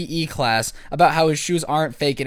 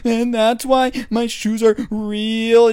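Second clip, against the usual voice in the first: high, slow whiny crying voice; mock the kid with a fake whimper; 6 semitones higher, 4 dB louder, slower than usual. Classmates teasing the kid, high mock crying voice. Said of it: "talk in that crying voice".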